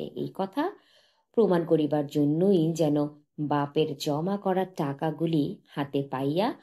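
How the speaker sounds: usual speaking voice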